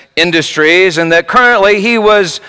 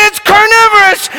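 high shouted hammed-up warning; mock alarmed shout; 4 dB louder than usual. Technician warns the crowd with a hammed-up shout. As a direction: shout it like staged panic. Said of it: "And then she said".

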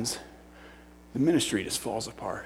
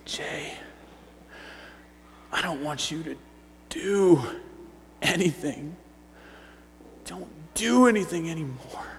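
tender reassurance; 3 semitones higher, 6 dB quieter than usual. Acted. Jesus comforting a crying man, tender and hushed. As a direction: reassure softly, leaving long pauses.

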